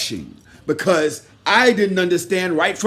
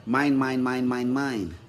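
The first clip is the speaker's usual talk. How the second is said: mocking greedy voice; 7 semitones lower, 3 dB quieter than usual. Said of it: "you was thinking".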